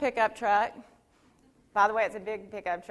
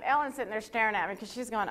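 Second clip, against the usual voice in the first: ordinary delivery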